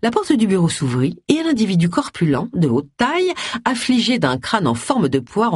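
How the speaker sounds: ordinary delivery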